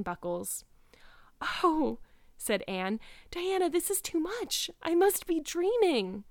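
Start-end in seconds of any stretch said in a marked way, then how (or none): 1.4-2.0 high, amazed little exclamation; awed gasp of delight. Acted. Anne awed by the slippers. 3.3-6.2 overwhelmed delight. Acted. Anne overwhelmed by second gift.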